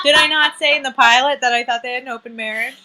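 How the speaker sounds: loud, excited gloating; triumphant. Real, not acted. A friend proving her prediction, loud and excited. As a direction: crow about being right. Called the open marriage.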